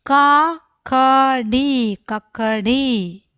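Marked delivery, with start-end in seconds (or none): 0.1-2.0 slow reading. Shown as a demonstration. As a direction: model കക്കടി slowly first. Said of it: "അക്ഷരങ്ങൾ ഓരോന്നോരോന്നായിട്ട് വായിക്കാം".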